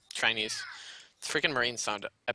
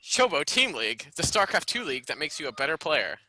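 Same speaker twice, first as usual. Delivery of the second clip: ad read voice; 3 semitones higher, 7 dB louder than usual. Pretend. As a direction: read it like a sponsor spot. Mock sponsor ad read.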